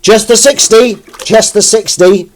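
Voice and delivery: high, loud put-on cry; hammed-up wail at the size of the job; mock exasperation. Counting how many tins are left, loud high mock dismay.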